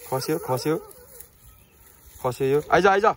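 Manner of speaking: high loud alarm at a fall. Real, not acted. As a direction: cry out as the dog slips. Dog about to fall.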